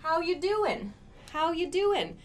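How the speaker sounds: "How you doing" run together, doing without the g; casual how you doing. Shown as a demonstration. Teacher showing casual greeting pronunciation. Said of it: "So you can hear my pronunciation".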